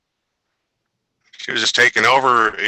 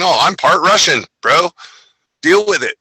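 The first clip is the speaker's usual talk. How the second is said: bro voice; 8 semitones higher than usual. Retold or imitated. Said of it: "and now America".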